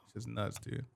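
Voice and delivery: breathy aside whisper; whispered amazement at how fast time goes. Real, not acted. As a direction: drop to a whisper in disbelief. Marvelling at time flying by.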